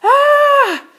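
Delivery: high, loud shriek of fright; boy sees his father turned dragon, screaming. A narrator's reading. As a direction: let out a shocked scream. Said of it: "screamed Radius".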